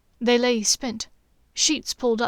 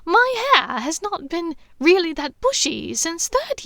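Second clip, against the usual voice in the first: laughing protest; 7 semitones higher, 3 dB louder than usual. A narrator's reading. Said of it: "laughing uncontrollably".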